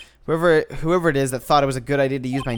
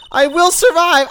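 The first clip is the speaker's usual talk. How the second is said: defiant shout; 11 semitones higher, 9 dB louder than usual. Acted. Maggie's defiant cry.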